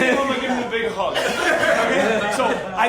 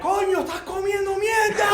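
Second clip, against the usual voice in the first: higher, shouted Cuban exclamation; incredulous shout; 10 semitones higher than usual. Retold or imitated. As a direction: bark it out in disbelief. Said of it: "and he's like".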